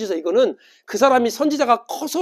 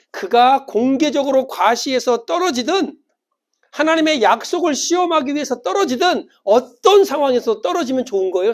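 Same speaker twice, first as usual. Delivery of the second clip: raised pitch, loud fervent preacher; impassioned preaching; 6 semitones higher, 6 dB louder than usual. Real, not acted. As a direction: preach it out at full force. Preaches at full force. (힘차게 외치는 설교)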